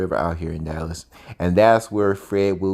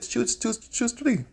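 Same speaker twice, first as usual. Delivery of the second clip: imitando al gángster con voz aguda; high mock gangster voice; 12 semitones higher, 5 dB quieter, slower than usual. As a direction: sing the urging up high. Gangster urges choice.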